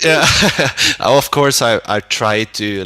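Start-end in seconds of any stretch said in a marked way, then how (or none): 0.3-0.9 a burst of high bright laughter; real laugh. Real, not acted. Band singer laughs at interviewer's joke, real laughter. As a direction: laugh it off, amused by the question.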